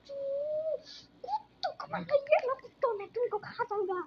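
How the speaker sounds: hammed-up dinosaur threatening to eat everyone. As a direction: roar it like a hungry dinosaur. Playing the dinosaur menacing.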